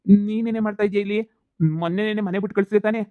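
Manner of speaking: ordinary talk, nothing marked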